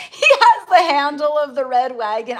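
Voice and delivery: can't hold back the giggles; bursting into laughter mid-story; laughing. Real, not acted. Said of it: "I'm sorry, I'm laughing".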